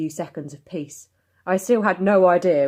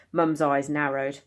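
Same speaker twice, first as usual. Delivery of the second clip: ordinary delivery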